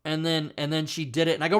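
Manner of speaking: normal talking voice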